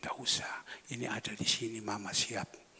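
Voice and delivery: soft, quick whisper; wife's whisper. Retold or imitated. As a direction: whisper it fast like the wife. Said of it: "Istri saya bisik". (bisikan cepat sang istri)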